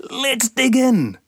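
very high, tiny buzzing insect voice; squeaky sandfly cry. Acted. Sandfly Sandy to Namu, squeaky high greedy cry. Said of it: "cried Sandy".

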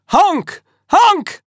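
high, squawking goose honk; screeching goose. A narrator's reading. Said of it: "screeched the goose".

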